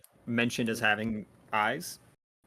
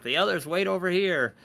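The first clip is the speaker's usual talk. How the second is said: high, loud goblin voice; little goblin attendant; 8 semitones higher, 5 dB louder than usual. Acted. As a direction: order people about as a small high-voiced goblin. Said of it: "And he's like".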